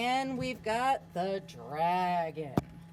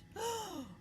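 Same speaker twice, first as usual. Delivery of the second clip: dramatic gasp; 13 semitones higher, 7 dB quieter than usual. Acted. Reader gasps.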